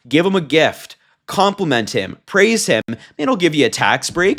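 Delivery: ordinary delivery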